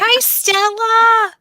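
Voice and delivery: 1 pet greeting. Real, not acted. Greeting the dog on camera.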